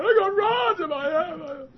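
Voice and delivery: loud, high, drawn-out mock wail; imitated crying. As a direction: wail it like a man bawling. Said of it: "Well, he's crying".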